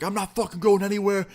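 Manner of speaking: high-pitched mock yell; imitated yelling. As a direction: imitate an angry kid yelling through a door. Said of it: "starts yelling through it".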